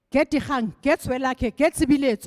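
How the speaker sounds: loud, acted angry orders; mad boss. Angry minister orders his assistant to get people on the phone, mad. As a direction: fire off names like a furious minister. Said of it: "whoever had made him mad".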